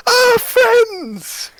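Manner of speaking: high, bright cheerful welcome; excited greeting. Acted. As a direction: greet old friends with delight. GM voices Orla greeting warmly.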